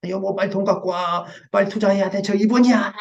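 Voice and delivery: a hammed-up panicky investor rushing his wife; urgent pushing. Pushy spouse rushing investment.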